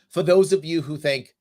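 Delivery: ordinary delivery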